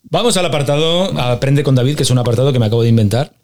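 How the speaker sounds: loud, upbeat show-host intro; segment announcement. Real, not acted. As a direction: announce the new segment with a big lift in volume.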